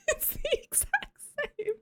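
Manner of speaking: high, weak squeal through laughter; helpless giggling. Real, not acted. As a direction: squeeze the words out while laughing. Cracking up over the impression.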